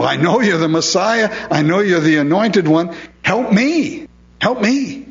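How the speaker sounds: acted high-pitched plea, climbing on "help me"; crying out. Preacher voices blind Bartimaeus begging Jesus, high-pitched crying out. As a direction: plead as the blind beggar, voice lifting. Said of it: "He just kept crying".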